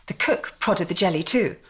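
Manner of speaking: plain reading voice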